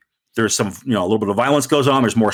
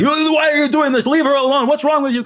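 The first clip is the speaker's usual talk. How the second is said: mock outraged bystander; angry guy. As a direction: confront him, outraged. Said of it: "coming at you like".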